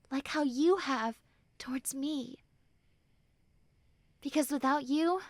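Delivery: ordinary delivery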